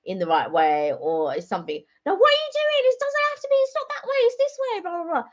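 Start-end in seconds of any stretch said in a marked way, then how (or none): 2.1-5.2 mock squabble in a much higher voice; high, exasperated mock bicker; petty bickering. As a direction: imitate nagging over something silly, high-pitched. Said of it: "we argue over the silliest things".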